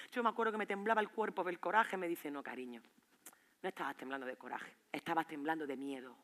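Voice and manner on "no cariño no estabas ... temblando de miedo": low, soft therapist voice; gentle correction. Retold or imitated. Psychologist tells patient it was fear, gentle correction. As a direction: correct her quietly and tenderly.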